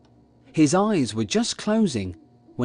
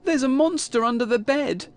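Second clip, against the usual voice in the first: acted upset, high child's voice; sobbing; 9 semitones higher than usual. A narrator's reading. Kipper telling his parents what's under the bed, mildly tearful. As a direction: plead high, a touch tearful. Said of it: "he sobbed".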